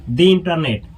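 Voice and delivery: "the internet" said as dee internet. Shown as a demonstration. Teacher explains the vowel rule, the pronounced dee. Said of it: "जो कि स्वर है".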